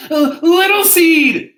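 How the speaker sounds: high, loud drawn-out shout; long storybook call at high pitch. A narrator's reading. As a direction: holler for your missing friend. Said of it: "he shouted".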